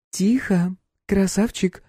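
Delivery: high teasing purr; mocking tease. A narrator's reading. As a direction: tease the captive. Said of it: "она похлопала пленника по щеке".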